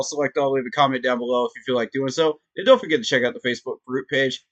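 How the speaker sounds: normal talking voice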